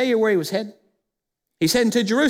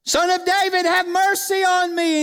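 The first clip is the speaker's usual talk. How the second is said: crying out; 9 semitones higher than usual. Acted. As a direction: call out desperately for mercy. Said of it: "he just kept screaming it".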